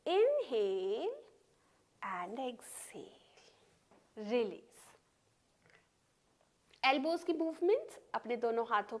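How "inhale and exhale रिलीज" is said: drawn-out breath cues. Real, not acted. Yoga teacher cueing the last round, drawn-out cues. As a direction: stretch both words, letting the second sink away.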